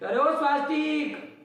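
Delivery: calling out loud across a distance; calling from afar. Shown as a demonstration. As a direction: call out to someone far away. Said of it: "ऐसा कहना पड़ेगा कि नहीं". (दूर से ज़ोर से पुकारना)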